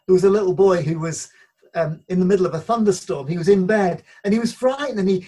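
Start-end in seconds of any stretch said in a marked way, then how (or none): none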